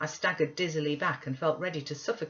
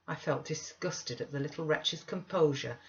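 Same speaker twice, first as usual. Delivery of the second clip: ordinary delivery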